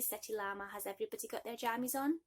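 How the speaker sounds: plain reading voice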